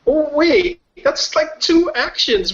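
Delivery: high, startled objection; wait, that's not fair; surprised protest. Real, not acted. Player protests the surprise move.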